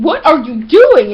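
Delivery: loud, drawn-out incredulous question; exasperated objection. Retold or imitated. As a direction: retell it as an exasperated demand. Acted startled objection to friend.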